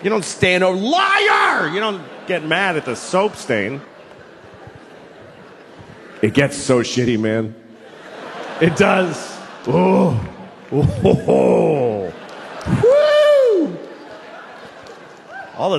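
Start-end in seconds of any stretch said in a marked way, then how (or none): 0.8-1.7 sudden high shouted accusation; hammed-up angry shout at a popped bubble; mock shout. Yelling at a burst bubble. 12.8-13.8 high loud whoop of joy; whooping cheer in mock celebration. Cheering about love.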